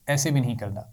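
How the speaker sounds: ordinary delivery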